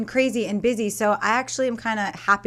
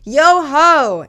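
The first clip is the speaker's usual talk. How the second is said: loud, high pirate call; 7 semitones higher, 12 dB louder than usual. Pretend. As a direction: bellow it like a pirate. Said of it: "in my best pirate voice".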